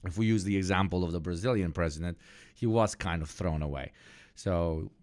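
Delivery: normal talking voice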